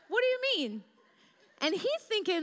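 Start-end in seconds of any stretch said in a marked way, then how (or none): 0.1-0.8 high, baffled question; confused reply. Retold or imitated. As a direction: sound genuinely lost. Her confused reply retold.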